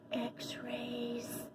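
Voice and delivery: breathy unvoiced whisper, barely there; 悄声说出突然想到的念头; whispered realization. A narrator's reading. Sudden idea, whispered thought.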